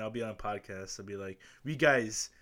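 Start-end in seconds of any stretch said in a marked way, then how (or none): 1.6-2.3 loud, slow showman announcement; announcer voice. Pretend. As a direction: announce the giveaway big and slow. Imagined podcast giveaway announcement.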